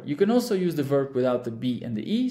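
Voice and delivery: ordinary delivery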